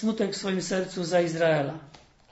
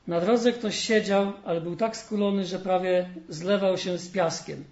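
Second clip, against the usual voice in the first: lively storyteller voice, livelier than the sermon; fairy tale reading; 2 semitones higher, 4 dB louder than usual. Acted. Preacher reading a fairy tale to the congregation, storytelling. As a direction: read the tale warmly to the congregation.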